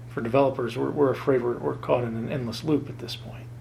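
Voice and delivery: ordinary delivery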